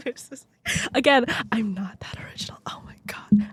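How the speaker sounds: whispered self-mocking aside; breath-only confession after laughing; embarrassed whisper. Real, not acted. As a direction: whisper it, mortified at your own pun. Embarrassed aside about her own pun.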